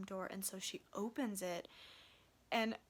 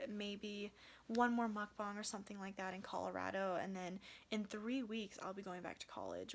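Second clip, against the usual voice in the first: ordinary talk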